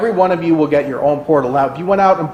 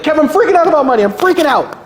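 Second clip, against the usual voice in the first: high, loud, fast panicky client; hammed-up money panic; mock panicked client; 10 semitones higher, 4 dB louder, faster than usual. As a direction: play the client who's freaking out about money. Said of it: "they'll be like".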